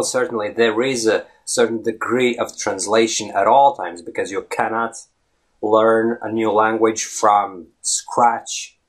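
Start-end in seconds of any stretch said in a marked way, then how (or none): none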